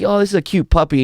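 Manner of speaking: high, loud mock gushing; gushing puppy admirer. Man admiring a puppy in front of his buddy, mock gushing. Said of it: "You're like".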